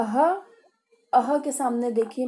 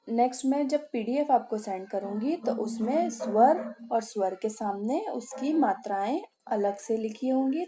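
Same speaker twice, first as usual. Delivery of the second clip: usual speaking voice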